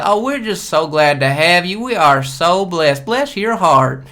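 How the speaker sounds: slow mock Southern hospitality voice; Georgia family accent. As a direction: coo it like a sweet Georgia aunt. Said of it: "my whole family talks like this".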